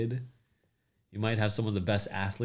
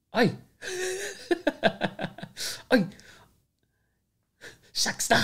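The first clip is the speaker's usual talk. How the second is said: Filipino accent; 4 dB louder than usual. Shown as a demonstration. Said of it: "we'll teach you the Filipino accent".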